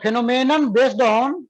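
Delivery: slow, clear dictation for students to write. Shown as a demonstration. Teacher dictating a definition to students, slow and clear. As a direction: spell it out slowly so they can copy. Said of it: "तो लिखो".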